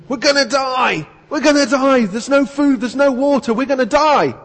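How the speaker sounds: high, loud panicked yelling; panicked screaming. Acted. Shipwrecked man yelling in panic to his companion. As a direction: scream it like you're sure you'll die. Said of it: "one of them started screaming and yelling".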